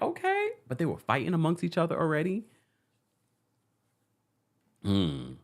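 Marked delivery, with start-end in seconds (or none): 0.0-0.5 sharp very high okay; high surprised okay. Retold or imitated. Host recalling first seeing the guests, surprised. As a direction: react with wide-eyed surprise.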